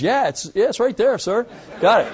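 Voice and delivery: high, eager co-pilot quote, pitch jumping up; co-pilot eagerly confirming the point for the pilot, high and keen; Scotty eager. Retold or imitated. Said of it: "And Scotty goes".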